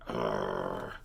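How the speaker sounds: deep sulky growl; low grumpy growl. A narrator's reading. Brother growls at Papa, very low and grumpy. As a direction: grunt it out like a grumpy bear. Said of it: "said Brother".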